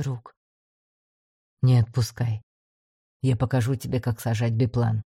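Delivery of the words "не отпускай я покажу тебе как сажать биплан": low, steady commander; calm low instruction. A narrator's reading.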